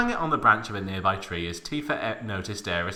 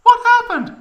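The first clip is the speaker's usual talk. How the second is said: squeaky high-pitched Tifa, louder; high girly Tifa voice; 12 dB louder than usual. A narrator's reading. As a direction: play Tifa with a shrill falsetto.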